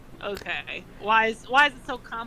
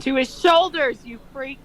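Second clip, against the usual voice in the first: mock outrage; 4 semitones higher, 7 dB louder than usual. Real, not acted. Reacting to fanfic line.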